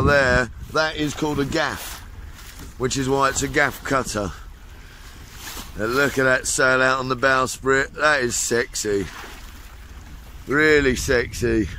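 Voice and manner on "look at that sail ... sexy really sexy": low admiring. Real, not acted. Admiring the sail, low and slow.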